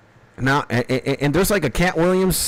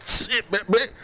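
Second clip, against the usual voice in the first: laughing while coughing; 8 semitones higher, 3 dB quieter than usual. Real, not acted. Coughing high on weed.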